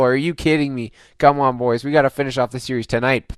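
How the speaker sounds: ordinary talk, nothing marked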